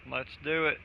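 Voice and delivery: usual speaking voice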